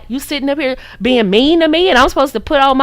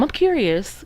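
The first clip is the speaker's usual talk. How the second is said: staring curiosity; 7 dB quieter than usual. Shown as a demonstration. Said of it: "And really look at 'em".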